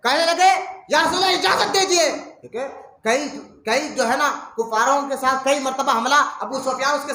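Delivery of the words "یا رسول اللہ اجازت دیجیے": raised, loud and stern plea; majestic wrath. Acted. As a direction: Umar blazing with righteous anger. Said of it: "اب جلال سے دیکھنے لگے".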